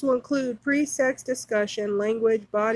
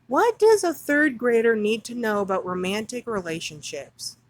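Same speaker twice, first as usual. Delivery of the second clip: ordinary talk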